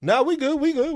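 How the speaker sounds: high, loud, hasty retreat; nervous backing down. Retold or imitated. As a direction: back off fast in a high panicked voice. Backing down from the cat.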